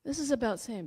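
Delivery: ordinary talk, nothing marked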